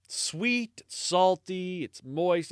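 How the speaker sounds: acted, lightly rapturous food praise; savouring. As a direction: linger on each word like a delicious bite. Priest savours ham in joke.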